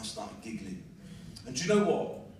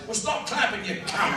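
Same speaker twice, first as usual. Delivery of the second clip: high-pitched heckle punchline; heckler's shout; 7 semitones higher, 4 dB louder than usual. Acted. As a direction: call it out like an exasperated heckler. Heckler's retort acted as punchline.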